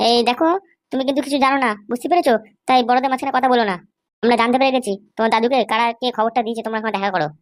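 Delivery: low, mocking thug voice; young thug. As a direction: taunt the old man from deep in the chest. Thug lectures the granddaughter. (নিচু গলায় ঠাট্টা করা গুন্ডা)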